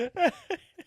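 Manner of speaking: a loud, high giggle rising at the end; high rising laugh. Real, not acted. Still laughing with his co-host after the punchline.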